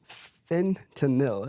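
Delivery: "fentanyl" drawn out slowly as FEN-tuh-nil; slow, deliberate FEN-tuh-nil for "fentanyl"; careful pronunciation. Shown as a demonstration. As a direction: pick through "fentanyl" syllable by syllable. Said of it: "how it's pronounced".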